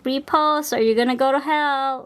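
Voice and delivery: mock chain message. Mocking a chain message that threatens repost or hell. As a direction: read the threat like a hammed-up spam message.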